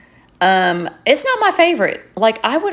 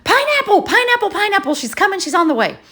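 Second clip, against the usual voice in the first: excited code word; 12 semitones higher, faster than usual. Retold or imitated. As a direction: call the signal like a giddy lookout. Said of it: "and I'm like".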